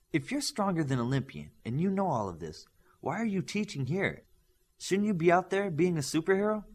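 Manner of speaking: a curious student pushing the teacher; questioning student voice. Acted.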